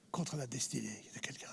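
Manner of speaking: trailing off into a whisper; soft whispered ending. Real, not acted. Solemn close of his story.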